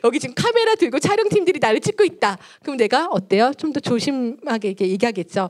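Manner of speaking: usual speaking voice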